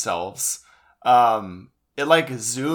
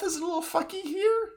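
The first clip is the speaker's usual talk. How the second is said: high silly voice; 4 dB quieter, faster than usual. Acted.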